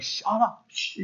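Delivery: very soft, hushed mock shushing; family shushing. Family shutting up the excited preacher, shushing. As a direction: hush it right down, imitating fed-up family. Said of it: "my family's like".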